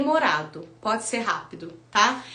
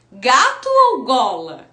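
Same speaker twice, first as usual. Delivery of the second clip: Gato ou gola asked slowly, each option clear; slow choice question to a child; teacher quiz; 2 semitones lower, 5 dB louder, slower than usual. Shown as a demonstration. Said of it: "O que que forma". (pergunta lenta de professora)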